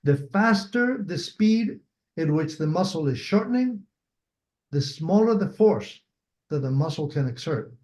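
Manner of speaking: measured reading of a key rule, phrase by phrase; slow reading. Shown as a demonstration. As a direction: read slowly, pausing between phrases. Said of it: "I'll read it slowly".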